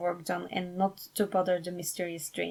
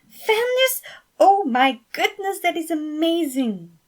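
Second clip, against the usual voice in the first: very high, loud amazed exclamation; much higher and louder, excitement fading out; amazed delight; 9 semitones higher, 6 dB louder than usual. Acted. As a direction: blown away by the news. Thomas reacting to the news.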